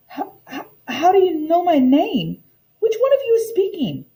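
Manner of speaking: high, quick, stammering alarm; a scared girl demanding answers; startled asking. Acted. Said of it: "I asked".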